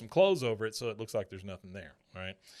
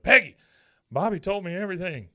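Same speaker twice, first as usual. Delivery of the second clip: high, loud outburst on the name; angry confrontation; 7 semitones higher, 10 dB louder than usual. Retold or imitated. Hank confronting Peggy at the last minute, angry. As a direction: burst in with an accusing shout.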